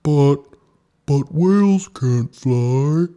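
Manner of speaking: a frightened whale stuttering his protest; panicky whale. A narrator's reading. Ooga protests to Snow Bear, panicky.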